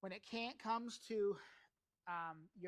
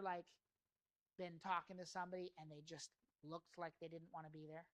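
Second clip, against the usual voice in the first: usual speaking voice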